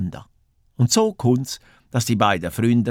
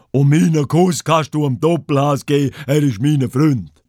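louder, higher bear voice calling over; 4 semitones higher, 4 dB louder than usual. A narrator's reading. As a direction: call it out loudly to the hut. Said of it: "ruft der Brummbär schnell". (lauter Zuruf des Bären)

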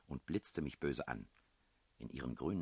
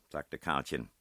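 usual speaking voice

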